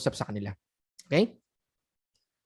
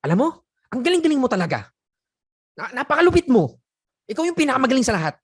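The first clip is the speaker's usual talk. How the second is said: mock angry praise; 7 semitones higher, 5 dB louder, faster than usual. Shown as a demonstration. Coach demoing praise said in anger, loud and sharp. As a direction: praise shouted high and loud, sarcastic. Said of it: "sasabihin ko sa iyo ng ganito".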